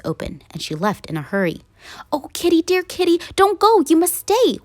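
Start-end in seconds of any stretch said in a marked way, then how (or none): 2.1-4.6 high pleading call, rising to a louder shout; high pleading shout after a runaway cat. Acted. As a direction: beg the cat to stay, calling after her. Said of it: "we shouted and cried".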